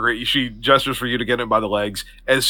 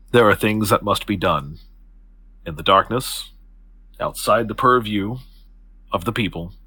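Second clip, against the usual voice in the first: lower, measured, formal woman; composed Octavia; 3 semitones lower than usual. Acted. Octavia puts her hands on the young man's shoulders, composed. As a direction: speak low and deliberately, with poise. Game master voices Octavia explaining.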